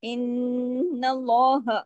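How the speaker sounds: इन्नल्लाह drawn out slowly with a heavy, full lam; Quranic recitation. Shown as a demonstration. Teacher recites इन्नल्लाह to show the heavy lam. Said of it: "हाँ की जबर को हमने पढ़ा".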